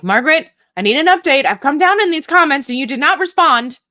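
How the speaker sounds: loud, fast, high mock-demanding tone. Host mock-scolding a viewer for no update, loud and fast. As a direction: playfully nag a commenter for an update. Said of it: "and be like".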